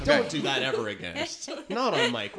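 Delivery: laughing while telling off; laughing scold. Real, not acted. Scolding laughing after loud noise.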